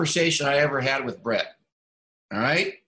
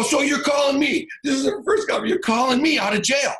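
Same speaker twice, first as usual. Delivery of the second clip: higher, strained half-shout of disbelief; can't believe the call, voice cracking up; incredulous retold yell; 10 semitones higher than usual. Coach outraged by jail call.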